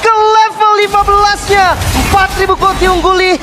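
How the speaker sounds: screaming, stretched-out hype call; fighting game announcer. Real, not acted. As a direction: roar the kill call to the crowd. Caster calls a maniac kill.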